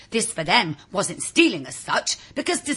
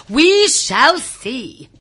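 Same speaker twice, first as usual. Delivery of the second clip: slow, drawn out and louder, knowing; teasing suspense; 5 semitones higher, 7 dB louder, much slower than usual. A narrator's reading. Narrator teases listeners about the folklore, slow and knowing. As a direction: leave them hanging, slowly.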